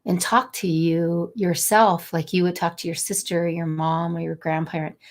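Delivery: ordinary delivery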